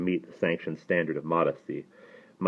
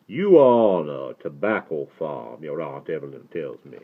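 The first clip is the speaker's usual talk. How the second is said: put-on mellifluous Southern accent; 3 semitones lower, 3 dB louder than usual. A narrator's reading. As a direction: open broad and ease down, in a Southern accent. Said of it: "talk in that accent".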